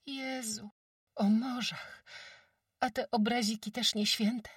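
put-on high, wide-eyed village girl; amazed girl's voice. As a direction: gasp it out in wonder. Jagusia amazed.

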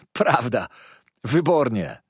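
emphatic, lightly acted cheer; delighted exclamation. A narrator's reading. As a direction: call out agreement with gusto. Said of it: "zawołał Ham".

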